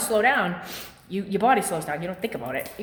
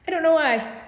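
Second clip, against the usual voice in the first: laughing; 8 semitones higher, 4 dB louder than usual. Real, not acted. Laughs at own calf.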